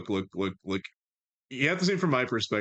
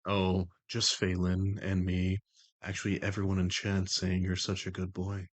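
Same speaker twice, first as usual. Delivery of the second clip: low, measured, even put-on voice; The Voice, composed and commanding; 4 semitones lower, slower than usual. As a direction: keep it low and level, in unhurried control. Holds The Voice.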